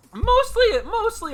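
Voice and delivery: sudden squeaky mostly, high; high squeak. Acted. Streamer blurts answer to chat, squeaky high. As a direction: pop the word up high.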